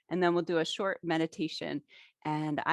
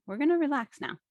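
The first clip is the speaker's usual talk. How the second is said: lightly voiced inner cue, higher pitch; voiced body cue; 9 semitones higher than usual. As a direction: gently act out the body's signal, pitched up. Voices the nervous system being cued.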